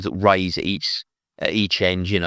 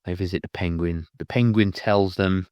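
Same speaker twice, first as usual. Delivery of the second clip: ordinary talk, nothing marked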